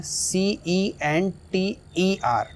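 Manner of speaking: slowly spelling out सीईएनटीईआर letter by letter. Shown as a demonstration. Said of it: "जैसे इसका example देखते हैं". (धीरे-धीरे एक-एक अक्षर बोलकर स्पेलिंग)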